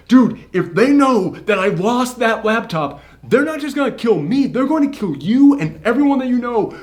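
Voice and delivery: high, urgent, frantic pleading; acted panicked warning. Villain warns protagonist they will both be killed, panicked. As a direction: play it desperate, pitch soaring throughout.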